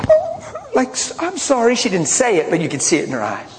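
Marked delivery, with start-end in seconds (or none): 0.1-1.7 high-pitched imitation of a sheepish girl; chastened apology. Girl realizing she screamed at her pastor, imitated high, sheepish apology. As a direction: squeak out a sudden little apology. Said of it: "She's calmed down, realized she was screaming".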